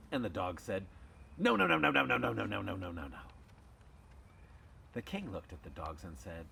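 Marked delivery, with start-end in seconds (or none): 1.4-3.2 rapid-fire denial. Acted. Dogs denying they bark loudly, hasty denial. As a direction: tumble down a long run of denials. Dogs deny the queen's accusation.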